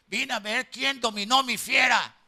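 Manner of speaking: shouting the challenge at the pastor; loud defiant demand, like an angry wife. Retold or imitated.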